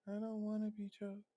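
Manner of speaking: soft, breathy-free hushed quote of her; quoting her. Retold or imitated. Repeat her soft reply to the caller, hushed. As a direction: say it soft and small. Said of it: "your response is".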